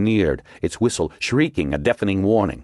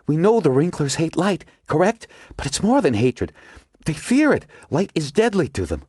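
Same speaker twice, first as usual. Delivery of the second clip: higher, urgent woman character; Margot's voice; 11 semitones higher than usual. A narrator's reading. Margot explains her theory to the agents, urgent and higher. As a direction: voice Margot pressing her discovery.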